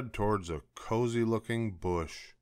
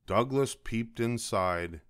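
plain reading voice

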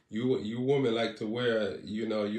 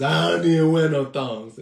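loud, hammed-up indignant quote; loud mock protest; mock viewer protest; 3 semitones higher, 9 dB louder than usual. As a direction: blurt it back like an offended viewer. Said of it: "There about five people tell us".

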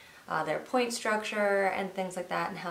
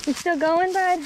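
excited; 8 semitones higher, 8 dB louder than usual. Real, not acted. Cheering tired dog on.

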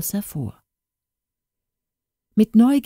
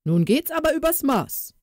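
raised scolding cook's voice; 8 semitones higher than usual. Acted. The cook scolding Katrin before attacking her, angry raised voice. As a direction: tell her off, fed up. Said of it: "schalt der Koch".

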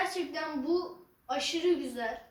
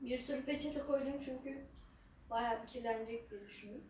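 quiet at night; 2 semitones lower, 13 dB quieter than usual. Real, not acted. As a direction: say it softly under your breath. Quiet asides while eating on camera.

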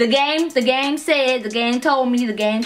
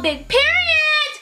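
high-pitched catchphrase; slower than usual. Acted.

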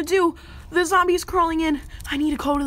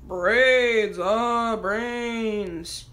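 zombie voice; 5 semitones lower, much slower than usual. Pretend. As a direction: moan it like a hungry zombie. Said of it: "I'm trying to act like a zombie".

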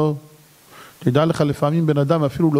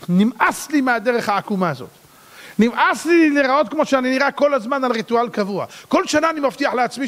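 usual speaking voice

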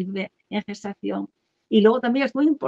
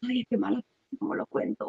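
soft, fading into an anxious whisper; worried mother; 2 semitones higher, 6 dB quieter than usual. Retold or imitated. Mother dismayed by the pregnancy, whispering in worry. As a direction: hush it like a secret you dread telling. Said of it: "Si la mamá dice".

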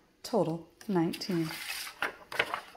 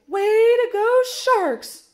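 very high, loud cheer; jubilant yell; 14 semitones higher, 8 dB louder, slower than usual. A narrator's reading. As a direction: yell congratulations to the whole team. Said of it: "Gil yelled".

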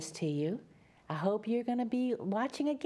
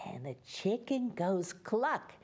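ordinary delivery, nothing marked

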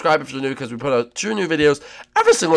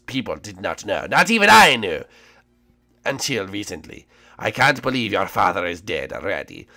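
French Koopa voice; 6 semitones lower than usual. Acted. French Koopa confides secret.

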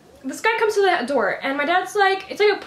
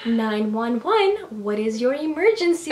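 imitated operator, lower and dragged out; slow dispatcher; 6 semitones lower, slower than usual. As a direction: draw out each word like an unhurried dispatcher. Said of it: "They're talking so slow".

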